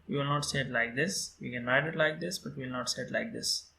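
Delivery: usual speaking voice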